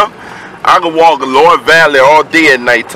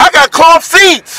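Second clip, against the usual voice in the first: high, drawn-out cry of alarm; high-pitched, dragged-out objection; alarmed protest; 13 semitones higher, slower than usual. Real, not acted. Alarmed over seats being peed on.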